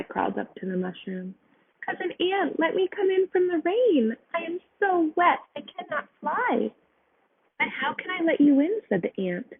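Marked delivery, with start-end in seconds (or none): 1.8-6.7 high little butterfly begging; pleading butterfly. A narrator's reading. 7.6-8.8 high, puzzled ant voice; doubtful ant. A narrator's reading. Ant answers butterfly.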